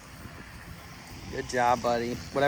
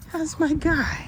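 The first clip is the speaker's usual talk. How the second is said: much higher, sing-song cooing; 13 semitones higher, slower than usual. Real, not acted. Cooing to dog.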